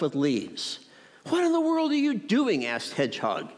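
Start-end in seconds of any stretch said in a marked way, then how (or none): 1.3-2.7 high-pitched, baffled exclamation; astonished question. A narrator's reading. Hedgehog finds mouse hiding under leaves, astonished. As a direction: ask it in high, animated disbelief.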